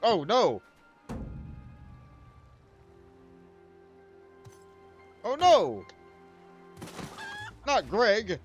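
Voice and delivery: high, loud dismayed cry; mock horror. Dismay as character leaves.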